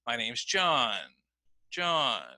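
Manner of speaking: "John" rises then falls low; falling intonation. Shown as a demonstration. Teacher models falling tune for statements. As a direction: let the voice drop on "John". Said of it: "notice my voice goes down".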